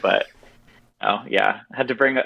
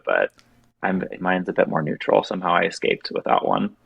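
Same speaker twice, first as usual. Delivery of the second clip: ordinary talk, nothing marked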